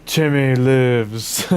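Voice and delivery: very slow, stretched-out cry; stretched, mock-dramatic call; drawn-out catchphrase. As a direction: draw out each word theatrically.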